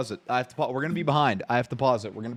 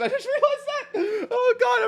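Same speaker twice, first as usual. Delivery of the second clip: high-pitched laugh through the words; cracking up at his own mistake; slower than usual. Real, not acted. Laughs at Netflix mix-up.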